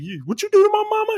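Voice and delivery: very high, fast mock kid; son's outraged voice. Son confronts Johnny over his mama, high fast outrage. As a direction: rush it out as an indignant boy.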